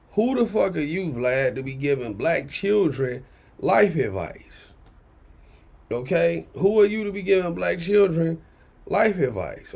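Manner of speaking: ordinary talk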